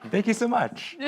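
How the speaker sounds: high giggly thanks; laughing. Real, not acted. Laughing after sung story.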